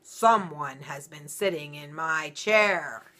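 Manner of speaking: loud, slow, booming papa bear; great big voice. Acted. Papa Bear finding someone sat in his chair, loud and slow. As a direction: boom it out like a huge bear. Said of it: "in his great big voice".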